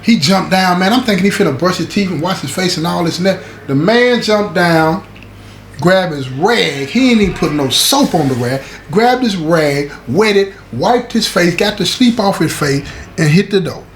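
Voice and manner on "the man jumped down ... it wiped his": slow, drawn-out telling. Real, not acted. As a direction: stretch each word out slowly. Slow dramatic retelling.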